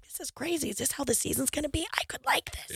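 hushed, breathy whisper; whispered retelling. Retold or imitated. Wife retelling her private thought about the game, whispered. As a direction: drop into a secretive whisper.

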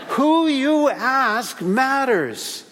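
slow, stretched, laughing through each word. Shown as a demonstration. Preacher repeating the point to the congregation, laughing. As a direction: chuckle through Who you ask matters, slowly. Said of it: "repeats the point laughing".

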